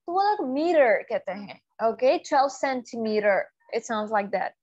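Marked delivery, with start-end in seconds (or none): 2.2-3.4 slow American "centimeter" ending "mee-rer"; American tapped R in "centimeter"; American accent. Shown as a demonstration. Teacher copies native speakers, American accent. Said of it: "American की".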